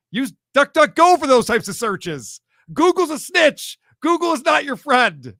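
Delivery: loud, slow and insistent; emphatic warning. Real, not acted. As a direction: hammer the warning home.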